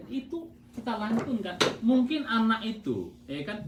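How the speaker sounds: ordinary talk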